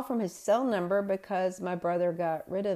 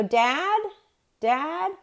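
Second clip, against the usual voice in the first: calling out; 8 semitones higher, 5 dB louder than usual. Retold or imitated. Answering phone, calling to dad.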